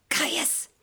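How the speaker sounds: urgent whispered name; a sharp whispered call in the dark; hissed whisper. A narrator's reading. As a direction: hiss it to keep from waking anyone. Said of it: "Livilla hissed in my ear".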